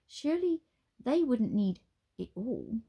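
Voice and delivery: usual speaking voice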